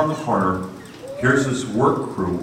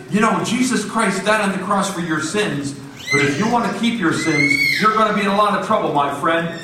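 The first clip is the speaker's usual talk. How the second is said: higher, bold warning; lightly voiced bold preacher; 10 semitones higher than usual. Miguel warning the giant worker, bold. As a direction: warn him boldly. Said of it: "and he says".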